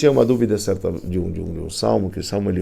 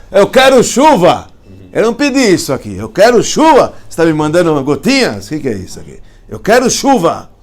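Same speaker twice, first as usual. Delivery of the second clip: much higher and louder, yelling for rain; angry shout at God; 7 semitones higher, 10 dB louder than usual. Retold or imitated. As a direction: demand it like a furious child.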